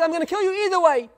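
high, fast exasperated threat; acted outburst, quick and high; retold angry threat. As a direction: fire it back heatedly, as in a retelling.

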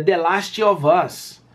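slow "The Last of Us", with "Last" as "lastchi"; supposed correction. Retold or imitated. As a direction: stretch it out, adding chee to the end of "Last". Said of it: "O cara tá pronunciando errado".

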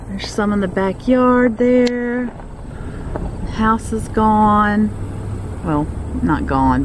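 usual speaking voice

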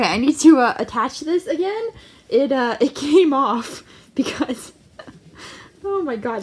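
usual speaking voice

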